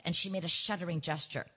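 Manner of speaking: ordinary delivery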